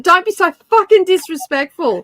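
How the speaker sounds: high, indignant retelling of a scolding; retold telling-off. Retelling the reply to being called a bitch, high scolding. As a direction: retell your own rebuke, pitched well up. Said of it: "this is what she did to me".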